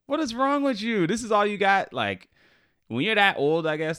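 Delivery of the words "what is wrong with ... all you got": high-pitched mock outrage at the customer; mock indignant. Retelling telling off the old diner, high and indignant. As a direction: squeak it out in disbelief.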